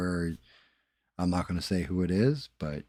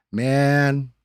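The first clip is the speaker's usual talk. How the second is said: higher, louder exasperated man; reacting loudly to chat; loud exclamation; 8 semitones higher, 9 dB louder than usual. Real, not acted. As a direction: blurt it out in disbelief.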